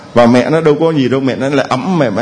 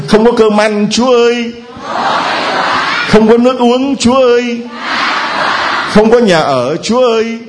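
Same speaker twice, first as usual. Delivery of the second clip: higher pleading wail to God; crying out to God; 8 semitones higher than usual. Shown as a demonstration. Preacher demonstrating how to cry out needs to God. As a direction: lament each need like a whining child. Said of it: "Thử kêu lên".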